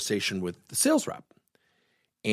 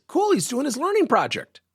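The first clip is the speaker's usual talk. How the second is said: much higher, gleeful acted thought; delighted inner thought; 14 semitones higher than usual. Coach privately pleased the rep is doing his project, delighted. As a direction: let secret delight lift the pitch right up.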